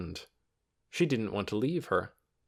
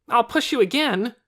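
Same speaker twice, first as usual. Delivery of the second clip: put-on loud threatening boy; 14 semitones higher, 9 dB louder than usual. A narrator's reading.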